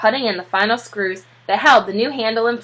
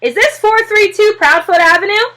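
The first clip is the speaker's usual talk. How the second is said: higher and louder, formal; a policeman checking the address; official policeman; 11 semitones higher, 6 dB louder than usual. A narrator's reading. Policeman arrives at door.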